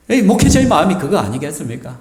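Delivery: ordinary talk, nothing marked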